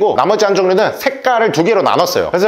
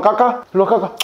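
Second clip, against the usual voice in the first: ordinary talk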